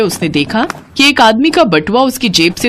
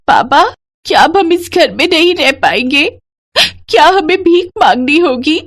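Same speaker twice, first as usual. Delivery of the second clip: put-on high-pitched child's wail; tearful daughter; 9 semitones higher than usual. A narrator's reading. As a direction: plead with father as a crying little girl. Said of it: "उसकी दोनों बेटियां रोने लगी". (रोती हुई बच्ची की ऊँची आवाज़)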